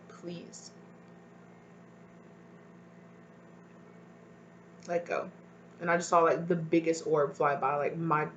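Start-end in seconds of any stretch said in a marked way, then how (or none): 0.1-5.3 soft, tearful, breaking plea to let go; almost crying as she begs. Real, not acted. To viewers after sharing her story, tearful and soft. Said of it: "me crying".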